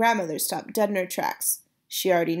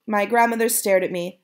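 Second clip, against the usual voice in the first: ordinary delivery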